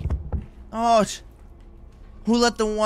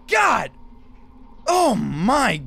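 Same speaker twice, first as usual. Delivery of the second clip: frightened yelling; 10 semitones higher, much slower than usual. Real, not acted. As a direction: shout it out in real fright. Reacting to a jump scare.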